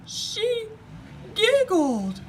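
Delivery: very high, loud blubbering cry; the king blubbers about her giggling. A narrator's reading. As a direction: bubble the words through tears. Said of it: "bubbled the king".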